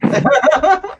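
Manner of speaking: high, loud burst of laughter; amused high-pitched laugh. Real, not acted. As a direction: laugh out loud at a friend's reaction. Laughs at listener's excited reply.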